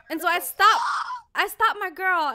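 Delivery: usual speaking voice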